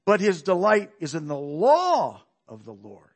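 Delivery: "law" swelled loud and long, the rest falling away; stress on "law". Shown as a demonstration. Preacher re-reading the verse for new meaning, stress on "law". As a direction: hit "law" hard, then ease off "of the Lord". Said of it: "Emphasize different words in a verse".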